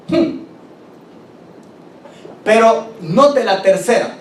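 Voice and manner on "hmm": ordinary talk, nothing marked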